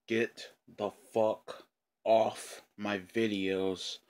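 very slow, word by word; emphatic order. Real, not acted. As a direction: pause between words for an angry command. Ordering trolls away.

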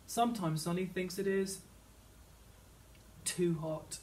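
usual speaking voice

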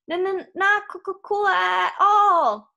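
high juddering cry, drawn out slowly; vibrating yell. A narrator's reading. Grover on the shaking bed, a loud vibrating wail. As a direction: wail it out, stuttering through the shakes. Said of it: "his voice vibrating from the million hand massage".

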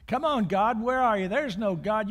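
loud, high mocking dare to the sky; taunting shout at God. Atheist at the park speaker stand daring God, loud mocking shout. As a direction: yell up at heaven, daring God to strike. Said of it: "he started counting".

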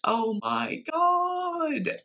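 slow, high, drawn-out awe; overwhelmed by the lyrics, let it hang; moved to tears. Real, not acted. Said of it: "My eyes just welling up".

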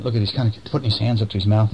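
usual speaking voice

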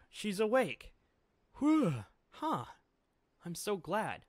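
high, bright relief, almost squealing; overjoyed high-pitched character voice; relieved excited squeal. Acted. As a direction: gush with relief that she's awake.

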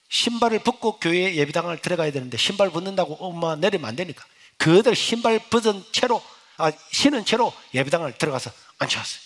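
normal talking voice

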